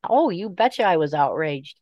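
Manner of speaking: ordinary talk, nothing marked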